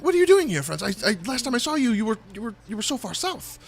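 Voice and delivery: high fast surprised halfling; Stefan's voice. Acted. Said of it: "Stefan says".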